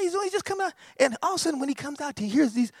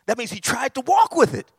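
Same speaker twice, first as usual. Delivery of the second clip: usual speaking voice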